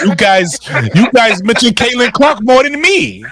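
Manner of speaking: high, loud protest; mock outraged shout. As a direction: shout it high in exaggerated disbelief. Mock outburst over Caitlin Clark mentions.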